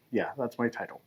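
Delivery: ordinary talk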